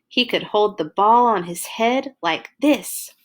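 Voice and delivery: put-on lively read-aloud for little kids; reading to children. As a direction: tell it like a bedtime storybook. Reads picture book to children.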